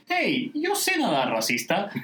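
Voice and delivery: mildly indignant, high drawn-out opening; annoyed. A narrator's reading. Troy defending his swimming to Shirley, annoyed. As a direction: object in a high voice, dropping at the end.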